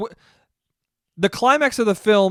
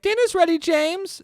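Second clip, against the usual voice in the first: high sweet put-on voice; spider mom; 12 semitones higher than usual. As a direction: call him in like a cartoon mom. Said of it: "It's like".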